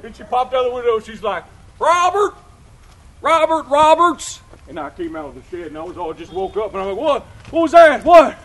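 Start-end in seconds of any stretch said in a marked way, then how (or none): 1.8-4.4 yelling a name. Retold or imitated. Sister yelling from window. 7.0-8.4 startled shout. Retold or imitated. Startled brother shouting back.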